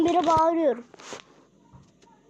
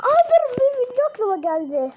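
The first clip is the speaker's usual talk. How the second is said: sudden surprise; 9 semitones higher, 6 dB louder than usual. Real, not acted. Suddenly remembers an idea.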